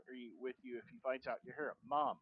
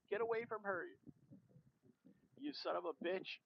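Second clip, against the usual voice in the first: much higher fierce cry; acted yell; 10 semitones higher than usual. Daughter crying out at the creature to leave her mother alone, high yell. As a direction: drive the beast off.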